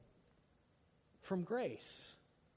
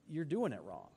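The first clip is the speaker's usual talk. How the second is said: "you're doing it wrong" said softer through a chuckle; softly laughing; 3 semitones lower, 4 dB quieter than usual. Real, not acted. Preacher chuckling as he tells the congregation: softly laughing. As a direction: let a laugh slip under the words.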